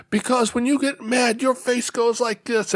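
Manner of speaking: high-pitched little-boy imitation; upset young son. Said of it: "he screws up his face".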